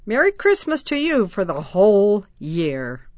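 loud, carrying call across the yard; loud festive greeting. Acted. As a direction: call out to the neighbors. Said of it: "Jeff called to them".

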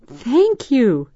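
high, loud, joyful thanks; elated child's exclamation; happy cry. A narrator's reading. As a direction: cry it out like a delighted little girl. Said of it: "Desiree cried happily".

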